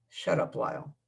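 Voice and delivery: soft, breathy shut up; hushed rebuke. Acted. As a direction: snap it out under your breath. Quiet hissed rebuke.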